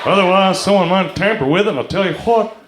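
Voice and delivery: Southern cartoon-dad airport announcer; Hank Hill. Texan announcer warning travelers about luggage, cartoon twang. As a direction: make a Texas twang announcement over the airport speakers. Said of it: "Hank Hill is hiding behind a curtain".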